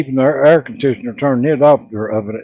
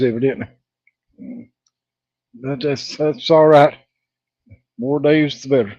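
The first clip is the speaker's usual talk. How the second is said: normal talking voice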